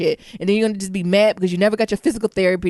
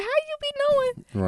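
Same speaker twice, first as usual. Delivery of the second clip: slow and pitched way up, imitating onlookers; incredulous high question; high puzzled mock voice; 6 dB quieter, slower than usual. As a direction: onlookers puzzled by her friends. Voicing puzzled people.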